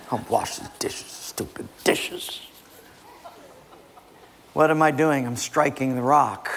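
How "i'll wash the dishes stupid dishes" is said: soft, unvoiced seething mumble; hammed-up grudging whisper; grumbling. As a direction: mutter resentfully under your breath, dragging the last words. Husband grumbling over the dishes.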